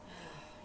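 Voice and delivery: long weary sigh out; deflated exasperated sigh. Real, not acted. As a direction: let out a tired sigh. Exasperated about husband's phone.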